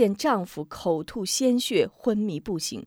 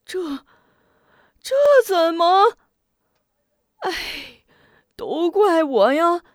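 much higher, loud wailing lament; weeping lament; 9 semitones higher, 8 dB louder than usual. Acted. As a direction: wail in showy grief, blaming himself. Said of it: "痛哭流涕". (痛哭流涕地哀叹)

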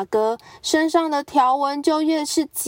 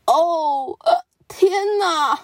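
much higher, slow dismayed wail; shocked cry; 7 semitones higher, slower than usual. Acted. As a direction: cry out in horror at yourself. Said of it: "斑马惨叫".

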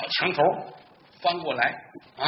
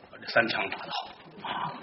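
whispered self-congratulation, much softer; smug whisper; 8 semitones lower, 9 dB quieter than usual. Acted. Bai Xiaoping praises his own three shots in a smug whisper. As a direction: whisper your pride to yourself. Hero's smug aside to himself.

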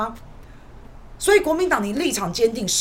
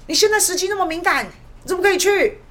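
higher, scolding mock accusation; mocking green media; 9 semitones higher, 4 dB louder than usual. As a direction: parody the media's shrill reproach. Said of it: "然后绿色的媒体说".

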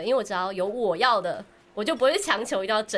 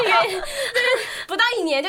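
high, laughing and choked; 10 semitones higher, 4 dB louder than usual. Real, not acted. Fan recalling her first year of idol fandom, laughing. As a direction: laugh in disbelief at herself.

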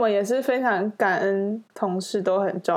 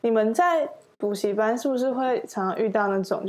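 ordinary talk, nothing marked

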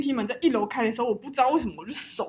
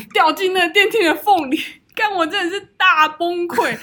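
laughing; 5 semitones higher, 6 dB louder than usual. Real, not acted. Laughing while telling the mishap.

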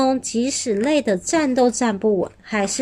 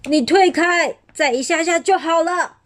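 roaring; 7 semitones higher than usual. A narrator's reading. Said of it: "霸王龙吼着".

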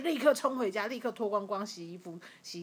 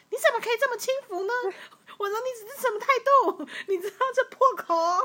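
higher, louder, fast outraged scolding; angry scolding; 12 semitones higher, 6 dB louder, faster than usual. Retold or imitated. Scolding her sister for going out to buy food, outraged. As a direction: tell her off for being careless. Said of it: "我就超 生气".

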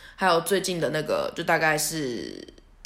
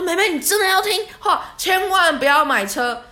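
a hammed-up earnest uncle begging her not to buy a car; earnest warning; 11 semitones higher, 7 dB louder than usual. Driver earnestly warns narrator.

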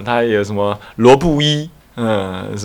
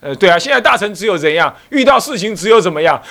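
normal talking voice

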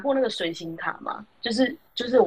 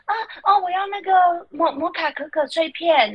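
put-on higher, childlike excited voice; happy kid; 8 semitones higher than usual. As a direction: play the thrilled child asking for her drink. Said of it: "小孩就很开心的说". (高音兴奋的小孩声)

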